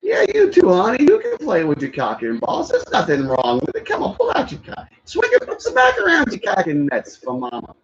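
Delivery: very high, swooping mom voice; Sandler's mom voice. Retold or imitated. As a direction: croon it like a creepy mom egging him on. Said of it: "I do a good impersonation of that".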